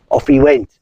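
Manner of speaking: ordinary delivery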